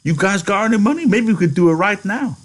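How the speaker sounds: high, quick, excited pitch; eager interruption. A narrator's reading. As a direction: cut in eagerly, rushing to persuade. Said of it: "Candy interrupted him".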